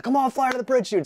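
high, loud eager pleading; excited urging. Retold or imitated. Freed prisoners begging the pilot to fly under the bridge, loud and high. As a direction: egg him on like a giddy passenger.

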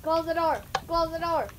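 high, loud, urgent command; panicked yell. Pretend. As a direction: yell it in a panic to keep the monster out. Panics as monster approaches.

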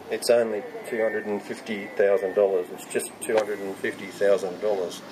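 low, understated quote of an official; mock downplaying. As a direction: drop the voice low to shrug off the sum. Quotes councillor playing down cost.

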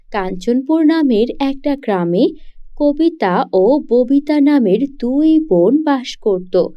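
ordinary delivery

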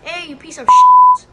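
screaming insult at the cab, very high and loud; full-throated shriek across the street; hailing a taxi. Pretend. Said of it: "I should censor it out".

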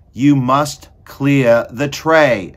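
loud, high, drawn-out shouted order; slow shout. A narrator's reading. Cook repeats the order to Meg, shouting slowly. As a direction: bellow each word slowly. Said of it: "shouted Cook".

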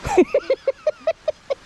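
high burst of laughter. Real, not acted. Laughs at arrow joke.

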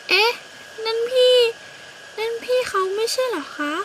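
put-on high, surprised little girl. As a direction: spot the rival with surprise. Little bird spots rival. (เด็กหญิงเสียงสูงแปลกใจเห็นพี่นก)

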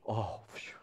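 low, soft exhaled relief; quiet relieved "phew"; mock relief. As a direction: let the tension out on a sigh. Relieved the text is harmless.

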